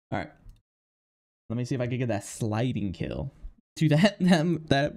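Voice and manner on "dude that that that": relieved and laughing; breathless laugh. Real, not acted. Gamer after a tense round, relieved laughter. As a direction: laugh then slow trailing.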